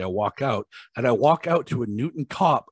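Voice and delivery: ordinary talk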